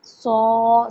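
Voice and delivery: ordinary talk, nothing marked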